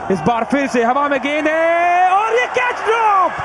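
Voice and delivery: ordinary talk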